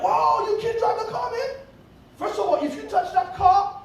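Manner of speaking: high alarmed shout of warning. Retold or imitated. Father shouting at son not to drive the car, alarmed. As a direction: yell the warning like a panicked dad.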